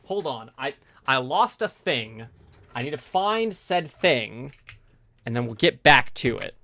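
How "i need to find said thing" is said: ordinary delivery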